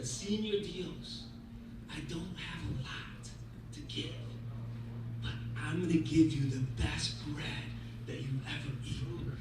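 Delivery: very soft, low and halting; humble prayer. Acted. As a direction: offer it quietly, with humble pauses. Humble secret prayer in story.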